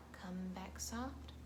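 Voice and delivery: quiet and gentle for the soft bird. Acted. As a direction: hush it softly. Soft blackbird told quietly.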